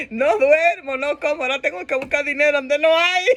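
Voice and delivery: whiny, hammed-up tearful complaint; mock crying. Exhausted parent complaining to God about money, mock crying. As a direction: sob out the list of hardships comically. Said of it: "estamos llorando por el próximo nivel".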